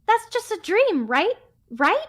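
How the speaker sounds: high, pleading character voice; worried, high-pitched acted question; anxious questioner. Voices worried listener in game.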